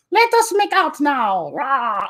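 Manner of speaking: put-on slow, very high Italian-accented falsetto; hammed-up high Italian accent; Gabriella, Italian accent. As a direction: stretch it out as a silly Italian puppet lady. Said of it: "I don't know why she's Italian".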